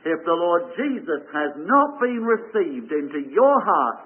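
normal talking voice

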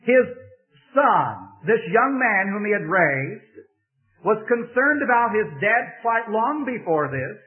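impassioned preacher building to a climax; preaching at full force. Acted. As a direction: drive the point home to the congregation. Sermon rises to full preaching.

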